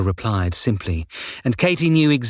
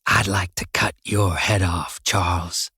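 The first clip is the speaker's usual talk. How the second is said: menacing hiss; 4 semitones lower than usual. A narrator's reading. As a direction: hiss the threat at him, low and cold. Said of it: "Kendall hissed".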